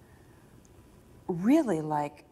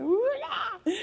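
high, laughing flustered squeal; wild retold yelp with laughter; discombobulated. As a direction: squeal in giddy confusion. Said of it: "we were so discombobulated".

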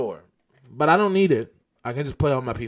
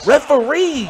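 loud, high mock protest; indignant calling out; 10 semitones higher, 8 dB louder than usual. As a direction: yell for the official to step in. Calling the referee over cheating.

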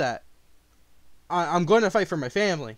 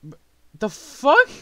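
incredulous; 8 semitones higher, 10 dB louder, slower than usual. Real, not acted. Reacting to lying accusation.

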